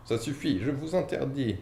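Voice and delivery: high, panicky protest; panicked. A narrator's reading. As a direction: blurt it out in a panic. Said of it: "pris de panique".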